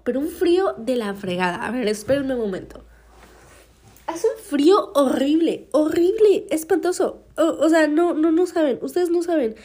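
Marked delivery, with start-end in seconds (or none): 4.1-7.2 exaggerated complaint. Real, not acted. Complaining dramatically about the cold. 7.4-9.5 high, pressed insistence; you have no idea, push it high; emphatic insisting. Real, not acted. Vlogger insisting to listeners about the cold, high pitched.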